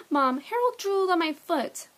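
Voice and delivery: high, loud complaint to mom; whiny tattling. A narrator's reading. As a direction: call out a disgusted tattle. Boy tattling to mother.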